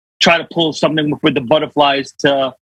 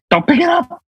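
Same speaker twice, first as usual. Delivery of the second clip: high, urgent horror-film warning; horror movie warning; 10 semitones higher than usual. Retold or imitated. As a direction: warn the victim like in Scream.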